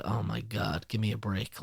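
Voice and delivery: fed-up homeowner groaning quietly at a late salesman; weary groan. Retold or imitated. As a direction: grumble it half to yourself. Exhausted man groaning at salesman.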